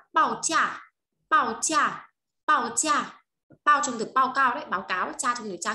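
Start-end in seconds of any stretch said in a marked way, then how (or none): 0.1-3.2 "报价" said slowly, higher and louder; model pronunciation. Shown as a demonstration. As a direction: drill "报价" clearly for learners. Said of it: "động từ là".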